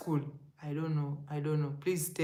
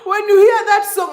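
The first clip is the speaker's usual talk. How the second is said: laughing; 10 semitones higher, 8 dB louder than usual. Real, not acted. Laughing about the song.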